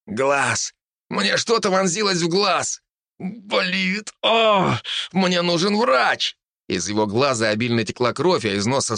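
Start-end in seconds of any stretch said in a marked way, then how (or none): 0.1-6.4 high, panicked cries of pain; pained screaming. A narrator's reading. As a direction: scream about the eye in agony. Wounded man screams in pain. (кричит от боли)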